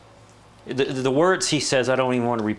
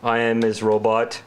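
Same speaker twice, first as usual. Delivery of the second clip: monotone, lower mock robot; 3 semitones lower than usual. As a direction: intone it evenly like a machine. Plays a robot after being teased.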